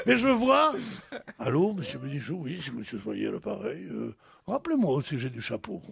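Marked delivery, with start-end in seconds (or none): 1.4-5.8 low, soft businesslike voicemail; lightly imitated manager on the phone; phone message. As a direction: leave a discreet message as an official.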